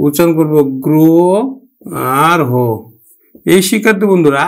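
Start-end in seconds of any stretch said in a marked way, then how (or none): 0.1-2.5 গ্র then হ apart, গ্র with ও; split word. Shown as a demonstration. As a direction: break গ্রহ into গ্রো-হ. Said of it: "ওকার দিয়ে".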